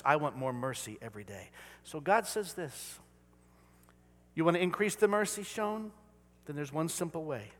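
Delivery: ordinary delivery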